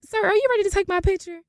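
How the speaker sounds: high, quick singsong question; chirpy deflection. Retold or imitated. To the DMV man before the photo, mock-chirpy. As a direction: pipe up sweetly to change the subject.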